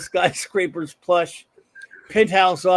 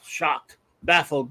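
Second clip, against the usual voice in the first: ordinary delivery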